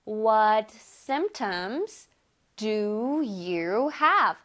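very slow, careful English; slow teacher demo; slow model reading. Shown as a demonstration. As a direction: spell out each word slowly for students. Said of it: "시작".

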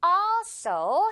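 also with the first vowel held long. Shown as a demonstration. Teacher shows how long the vowel is, stretched also. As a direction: draw out the al of also, then a short so. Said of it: "어 부분은 길게".

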